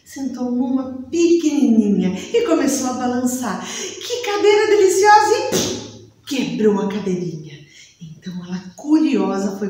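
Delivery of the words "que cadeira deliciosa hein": much higher, delighted squeal. Acted. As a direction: sound thrilled with the little chair. Balança na cadeirinha feliz. (voz aguda e encantada)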